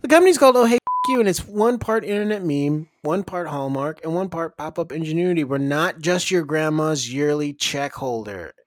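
upbeat commercial announcer voice; ad read. Acted. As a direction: sell it like a TV ad. Mock ad read for sponsor.

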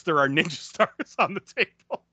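breaking into a laugh mid-line. Real, not acted. Host cracking up over ninja stars, laughing. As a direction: let the laugh burst through the words.

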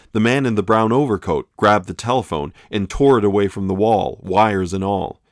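usual speaking voice

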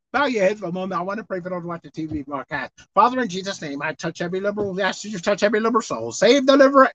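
ordinary talk

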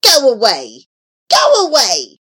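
sharp, loud repeated shriek. A narrator's reading. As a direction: shriek it as angry flames driving someone off. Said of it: "the flames shrieked".

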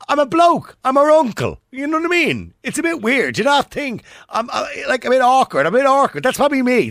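a flustered man pitching his voice way up in protest; high-pitched excited rant. Real, not acted. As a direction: voice jumps high and loud, flustered. Host rants about feeling awkward.

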